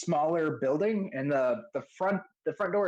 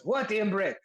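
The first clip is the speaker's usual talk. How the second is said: higher-pitched put-upon reply; other smith answering; 5 semitones higher than usual. Acted. Armorer answers Imbric from the back, calling back. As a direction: answer a summons from the back room. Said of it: "the other voice".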